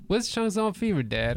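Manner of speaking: put-on high curious kid voice; imitating a young daughter's question; daughter asking. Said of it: "She said".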